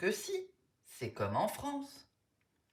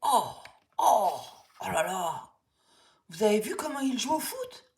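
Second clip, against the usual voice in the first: amazed; 2 semitones higher, 4 dB louder than usual. Acted. Kids amazed at Boris's football.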